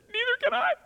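high, half-laughing, half-crying reply; a choked, teary laugh of disbelief; laughing through tears. Retold or imitated. Preacher retelling his own overwhelmed answer to the waitress, laughing and teary. Overwhelmed giving the hundred-dollar tip.

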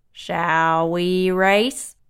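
very slow, deliberate tortoise. Acted. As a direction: drag each word out steadily. Tortoise challenges calmly.

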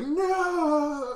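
high, loud, long-held wail; mock doomed scream. Family expecting to melt at sunrise, hammed-up scream.

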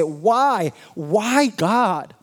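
rising pleading cry to God; mild anguished plea to God. Acted. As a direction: cry out why, climbing. Voicing the grieving believer.

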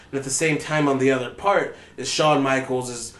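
usual speaking voice

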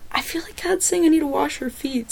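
hushed unvoiced whisper to the leader. Whispering an awkward request to her leader. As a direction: lean in and whisper it, a little embarrassed.

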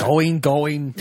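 an Arnold try that comes out like Bernie Sanders; a failed Arnold that lands on Bernie; Arnold impression. Cohost attempts the Arnold line on request, Bernie-like failed impression. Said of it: "you sound like Bernie Sanders".

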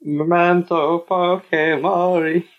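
slow, high mock-Italian accent. Co-host answering with own take, slow high Italian accent. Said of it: "I'm doing an Italian accent".

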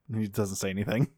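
usual speaking voice

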